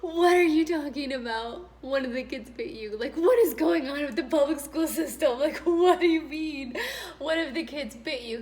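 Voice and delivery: high, loud, half-laughing outrage; stunned disbelief. Retold or imitated. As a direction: squeal at the absurd news. Retelling her reaction to the bite.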